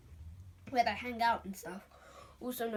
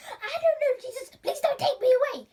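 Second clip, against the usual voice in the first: much higher, louder, frantic with a laugh; panicked pleading; 11 dB louder than usual. Acted. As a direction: wail the plea. Friends freaking out.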